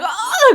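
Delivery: high, drawn-out moan of pain; mock dying groan. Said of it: "like, dying".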